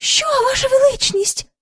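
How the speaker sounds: very high-pitched startled question; crying out. A narrator's reading. Courtiers startled by the king's outburst, crying out. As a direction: squeal it in alarm. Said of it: "скрикнули придворні".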